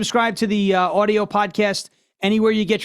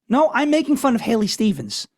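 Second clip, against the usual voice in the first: normal talking voice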